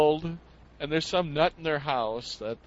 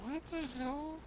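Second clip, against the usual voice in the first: high baffled; 11 semitones higher, 15 dB quieter than usual. Retold or imitated. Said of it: "I'm like".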